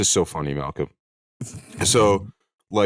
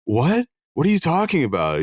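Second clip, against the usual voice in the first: confused; 9 semitones higher than usual. Retold or imitated. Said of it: "they're like".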